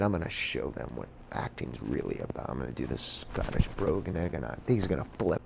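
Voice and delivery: very low, soft aside; hushed, low-pitched inner monologue; hushed scheming. Retold or imitated. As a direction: confide your plan secretly to yourself. Retold private plotting before class.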